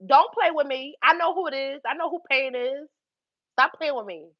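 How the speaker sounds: ordinary talk, nothing marked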